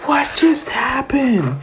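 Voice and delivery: high, slow, stunned question; stunned crowd. Retold or imitated. Crowd reacts to the blast, high and slow. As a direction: drag it out in high disbelief.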